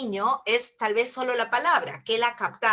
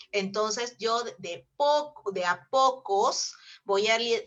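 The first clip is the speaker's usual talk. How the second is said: slow, measured; slower than usual. Shown as a demonstration. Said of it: "muestra lo dosificado".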